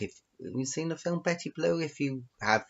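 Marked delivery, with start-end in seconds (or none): none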